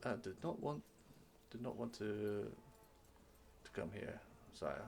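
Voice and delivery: soft, halting bad news; apologetic guard. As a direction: stammer an apology to the prince. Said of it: "The guard comes back and goes".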